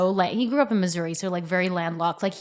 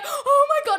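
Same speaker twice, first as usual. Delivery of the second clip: squeaky, very high half-laughing wail; mock screaming panic. Mother retelling her reaction to the snail, mock panic. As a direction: imitate your own tearful panic. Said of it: "I started screaming".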